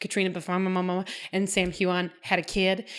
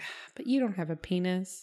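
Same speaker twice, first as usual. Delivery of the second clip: soft, dry mock Claire line; 2 semitones lower, 8 dB quieter than usual. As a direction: drily deliver Claire's comeback. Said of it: "she's like".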